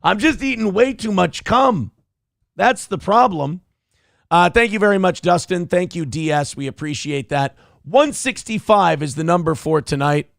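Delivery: ordinary talk, nothing marked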